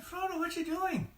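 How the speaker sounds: high anxious Sam voice; worried Sam. Acted. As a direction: sound alarmed, plead with your friend. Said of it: "He said".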